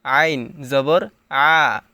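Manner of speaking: আইন জবর আ pressed from mid-throat, slow; throaty ain. Shown as a demonstration. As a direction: squeeze আ in the throat. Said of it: "গলার এখানে একটু চাপ দিতে হবে।".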